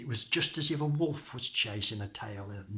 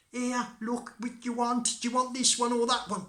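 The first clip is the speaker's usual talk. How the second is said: high, raised little-Tom voice; calling out as Tom; 12 semitones higher, 5 dB louder than usual. Acted. Tom calls to the robbers from inside, high and loud. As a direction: call out carelessly in a high voice.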